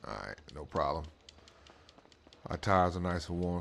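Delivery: usual speaking voice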